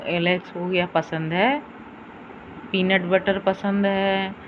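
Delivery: ordinary delivery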